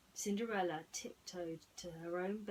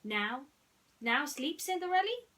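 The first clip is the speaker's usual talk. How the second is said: high, louder mouse voice rising; eager mouse question; 8 semitones higher, 8 dB louder than usual. Acted. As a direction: ask hopefully like a tiny mouse. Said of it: "Gus asked".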